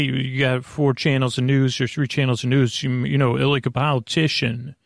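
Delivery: ordinary talk, nothing marked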